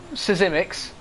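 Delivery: Sisimics in three syllables, si-ZIM-iks; provisional pronunciation. Shown as a demonstration. Commentator settling on a name for now, Sisimics in three syllables. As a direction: say Sisimics with an extra opening syllable. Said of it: "I'm gonna call him".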